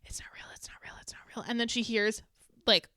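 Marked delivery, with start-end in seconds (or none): 0.0-1.4 hushed, rapid whisper, repeated like a mantra; imitated frantic prayer. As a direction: whisper it as a desperate self-soothing chant. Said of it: "she's praying, and then she just starts going".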